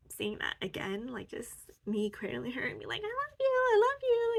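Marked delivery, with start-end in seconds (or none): 3.0-4.4 very high, louder loving coo. Retold or imitated. Cradling her younger self, high loving coo. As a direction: coo it like to a baby.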